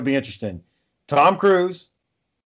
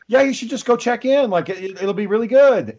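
Tom Cruise impression; 7 semitones higher than usual. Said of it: "he's like".

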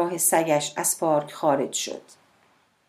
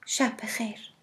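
calm, softer good night; soft, calm شب بخیر; 5 semitones higher, 8 dB quieter than usual. A narrator's reading. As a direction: say شب بخیر softly and calmly, falling at the end. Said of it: "با لحنی آرام گفت".

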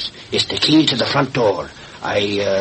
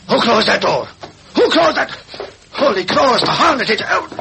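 high, frightened cries of alarm; startled and scared, voice jumping up high; panicked alarm; 12 semitones higher than usual. Acted. Reporter trapped in the murder house, crying out in panic.

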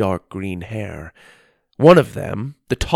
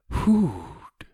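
thin, airy, falling food; the stranger repeating Doug's word in his own thin voice; wispy stranger's echo; 4 semitones higher than usual. Acted. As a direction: echo the new word slowly like a discovery. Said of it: "he repeated".

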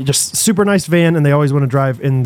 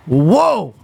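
drawn-out whoa, rising then falling; a small kid's mock whoa; lightly voiced startled toddler; 2 semitones higher than usual. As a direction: imitate a toddler's surprised whoa. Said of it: "I just hear Bo go".

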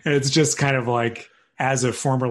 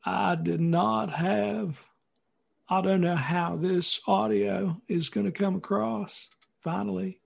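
slow, drawn-out presidential impression, pitched up; higher-pitched, unhurried politician voice; Bill Clinton; 7 semitones higher, slower than usual. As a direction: imitate a famous president, stretching the words out slowly. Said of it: "pretty good Bill Clinton".

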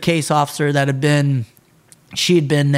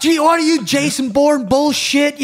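retold screaming; 10 semitones higher than usual. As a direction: scream at the trainee like a range instructor. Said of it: "I'm screaming at her".

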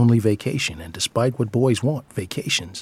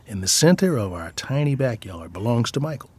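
ordinary delivery, nothing marked

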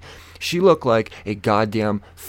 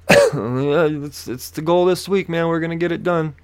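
higher, put-on agreeable voice; happy acting; 6 semitones higher than usual. Passenger playing along with the officer, put-on upbeat. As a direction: act cheerfully on board. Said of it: "put on my best acting face".

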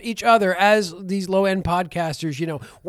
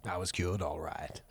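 much lower, softer murmur; 12 semitones lower, 13 dB quieter, slower than usual. Pretend. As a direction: mutter it low and quiet. Echoed quote, low and soft.

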